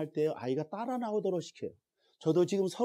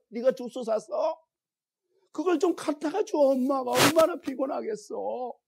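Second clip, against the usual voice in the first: mother's testimony; 10 semitones higher, 8 dB louder than usual. Retold or imitated. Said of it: "막 펑펑 우는 거예요".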